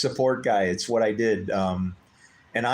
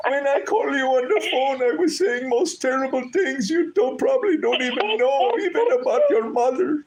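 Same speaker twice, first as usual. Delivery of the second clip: imitating tearful caller; 13 semitones higher, 5 dB louder than usual. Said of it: "And he goes".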